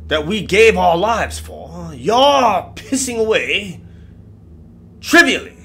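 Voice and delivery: put-on loud, indignant old English colonel; old English accent. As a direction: rail in old English, high and forceful. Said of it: "in his old English accent".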